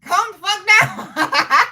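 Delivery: high, laughing "calm down" at an aggressive New Yorker; laughing as she tells a hothead to calm down; laughing mock telling-off. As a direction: scold them through laughter, loud and high. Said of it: "I be like".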